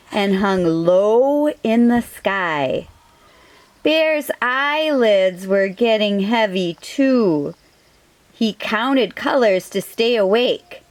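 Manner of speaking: plain reading voice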